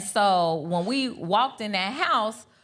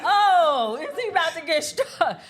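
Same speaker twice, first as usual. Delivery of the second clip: a higher, laughing mock gasp of shock; laughing disbelief; 8 semitones higher, 4 dB louder than usual. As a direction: play the shock of the moment for laughs, laughing through it. Retold shocked thought about husband.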